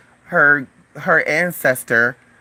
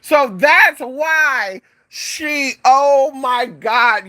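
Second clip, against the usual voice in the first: high, long-drawn disbelief; real, drawn-out exasperated amazement; dawning realization; 8 semitones higher, much slower than usual. Real, not acted. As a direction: stretch the words out as it dawns on you. Realizing her insult was a compliment.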